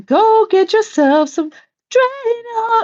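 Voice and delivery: very high, slow sing-song; mock sing-song. Teasing husband Brian about the clogged drain, high sing-song. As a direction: tease it out up high like a little tune.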